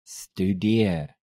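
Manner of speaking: "Studeer" drawn out slowly; slow demonstration. Shown as a demonstration. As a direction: stretch each syllable of "Studeer". Teacher repeats word slowly.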